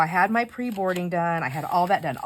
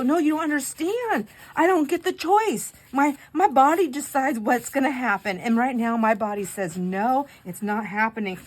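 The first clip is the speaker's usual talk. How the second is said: high, loud retold insistence; emphatic protest; 6 semitones higher, 6 dB louder than usual. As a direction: argue it emphatically, easing down toward the end. Retold argument about body refusing.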